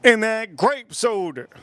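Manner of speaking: high mock voice of friend, slowing at the end; imitating friend. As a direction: squeak it, then drag out grape soda. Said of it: "He goes".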